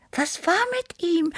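very high, thin little whisper; whisper-voice it in a tiny high pitch; whispering Tippi. A narrator's reading. Small cat asks about the found drummer, whispering. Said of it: "wisperte Tippi".

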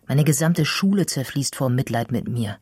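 usual speaking voice

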